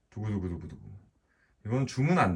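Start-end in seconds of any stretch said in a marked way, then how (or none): 0.1-0.9 soft mouth drumroll effect. Real, not acted. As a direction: do a quiet drumroll with your mouth.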